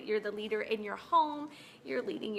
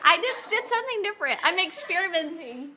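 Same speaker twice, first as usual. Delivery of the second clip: laughing; 6 semitones higher, 5 dB louder than usual. Real, not acted. Still laughing with friend.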